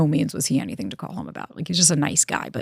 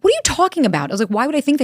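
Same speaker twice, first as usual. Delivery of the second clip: high-pitched, louder baffled retort; incredulous; 9 semitones higher, 5 dB louder, faster than usual. Retold or imitated. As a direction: retell it in exaggerated disbelief. Retold baffled answer to friend.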